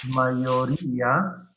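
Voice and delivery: mayoría said slowly, each syllable drawn out; slow careful word. Shown as a demonstration. Teacher dictating the word slowly to the class. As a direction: stretch "Mayoría" to show the hiatus. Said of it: "spelling out accented word".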